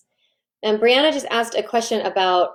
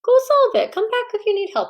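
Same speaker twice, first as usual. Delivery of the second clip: upbeat parent line; 11 semitones higher than usual. Shown as a demonstration. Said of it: "training that person that".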